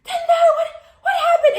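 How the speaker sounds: very high, loud mock shriek; panicked mom. Mom seeing her hurt daughter, panicked shrieking. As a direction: shriek it like an alarmed mother. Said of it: "my mom's like".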